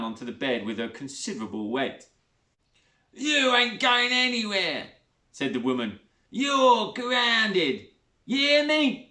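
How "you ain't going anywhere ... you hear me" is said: high, loud scolding; a bully laying down the law; Sheila, bullying stepmother. A narrator's reading.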